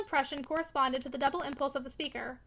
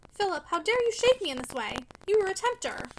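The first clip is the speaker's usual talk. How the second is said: higher and louder, stung protest; shaken accusation; 6 semitones higher, 5 dB louder than usual. Acted. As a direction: throw the accusation back at him. Maggie shaken, accuses Philip.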